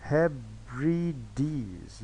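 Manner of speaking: हेब्रिडीज drawn out as three very slow, separate syllables; slow teacher's breakdown of हेब्रिडीज into three syllables. Shown as a demonstration. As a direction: spell out the beats of हेब्रिडीज, pausing between each. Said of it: "तीन syllables यहाँ पाए जाते हैं".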